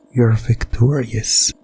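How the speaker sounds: normal talking voice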